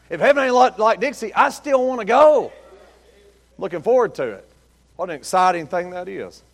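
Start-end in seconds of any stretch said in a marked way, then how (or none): 0.1-2.5 emphatic correction. Acted. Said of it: "But man, uh-uh".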